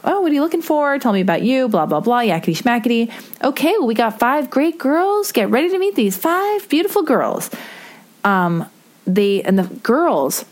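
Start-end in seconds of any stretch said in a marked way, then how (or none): 0.0-7.5 a lightly voiced, higher gushing TV host; chirpy game-show host hamming up the intro. The dating show host greeting the contestant, in a lightly voiced, higher gushing TV host manner. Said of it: "and so she's like".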